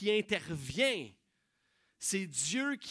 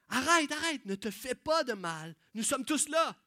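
higher, louder pleading stop; urgent call; 6 semitones higher, 5 dB louder than usual. Acted. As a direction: stop a man from hurting himself. Paul stops the guard.

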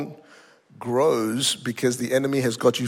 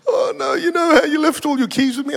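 high, wailing imitation of a distraught assistant; put-on crying voice; mock tearful; 13 semitones higher, 4 dB louder than usual. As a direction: whimper it like a caller dreading her confession. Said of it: "She's in tears".

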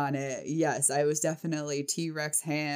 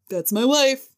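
louder, higher mock proud "wife"; proud husband; 7 semitones higher, 8 dB louder than usual. Husband smirking about his wife, louder and proud. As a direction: beam over "That's my wife".

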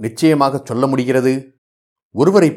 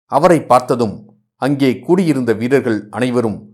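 ordinary delivery

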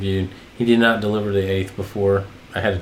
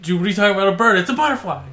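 lightly imitating a stressed, higher exasperated retort; mock exasperation, a little higher; stressed-out Peacemaker; 8 semitones higher, much faster than usual. Retelling John Cena's scene, mild stressed imitation. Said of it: "John Cena is so stressed out".

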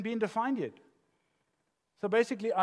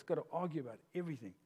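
rational voice; 2 semitones lower, 9 dB quieter, much faster than usual. Acted. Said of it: "The rational voice".

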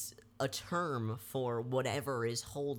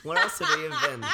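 laughing; 13 semitones higher, 9 dB louder than usual. Real, not acted. Laughing at obvious answer.

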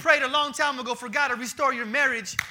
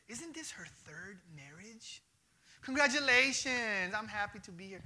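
lower, softer catty wedding-guest aside; mock gossip at a wedding; 3 semitones lower, 7 dB quieter than usual. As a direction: murmur it like a snide guest. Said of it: "Or how about this?".

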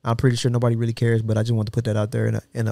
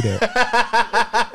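high, slow, laughing through it; 11 semitones higher than usual. Real, not acted. Agreeing to play drunk Mario Kart, laughing. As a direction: let the laugh carry the words.

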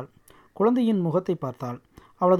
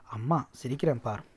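soft, lower, understated reassurance; subdued mother; 5 semitones lower, 7 dB quieter than usual. A narrator's reading. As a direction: put on a small brave smile, quietly. Mother forcing a smile through tears.

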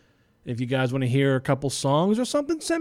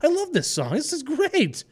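giddy listener quote, pitched way up; thrilled listener; 6 semitones higher, 3 dB quieter than usual. Retold or imitated. Listener rediscovering an old song, gushing way up high. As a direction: gush like a fan hearing an old favourite. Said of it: "shows you listen to and say".

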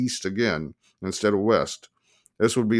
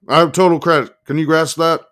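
impatient insistence; 7 semitones higher, 7 dB louder than usual. A narrator's reading. Boasting impatiently down the phone.

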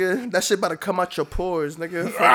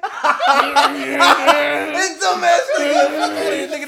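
high, loud laughing heckle; laughing shout; 8 semitones higher, 6 dB louder, much slower than usual. Real, not acted. Friend heckling the host during setup, laughing shout. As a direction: jeer through real laughter.

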